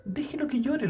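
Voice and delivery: lightly exasperated, high voice; mock-annoyed protest. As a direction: act out cutting in on the child's behalf. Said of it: "le digo yo".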